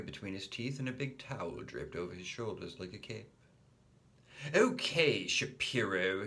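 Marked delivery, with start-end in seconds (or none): 4.5-6.3 put-on boy shouting a challenge in play. A narrator's reading. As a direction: call your rival out loud. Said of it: "he shouted".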